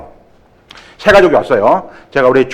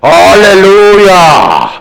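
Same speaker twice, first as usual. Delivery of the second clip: slow, drawn-out, loud, higher hallelujah; booming hallelujah; 4 semitones higher, 6 dB louder, much slower than usual. Shown as a demonstration. Greeting newcomers the wrong way, a long loud shout. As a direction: belt the 할렐루야 out long and loud, the way not to greet. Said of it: "절대 이 말하면 안 돼요".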